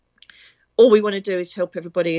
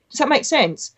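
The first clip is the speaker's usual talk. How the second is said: ordinary delivery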